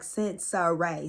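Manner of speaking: Sincere said sin-suh-RAY; Sincere with three syllables, ending in RAY; corrected name pronunciation. Retold or imitated. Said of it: "check him for saying her name wrong".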